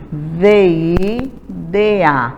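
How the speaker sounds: slow, letter by letter; spelling aloud. Shown as a demonstration. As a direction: spell the word out for young pupils. (soletrando devagar, letra por letra)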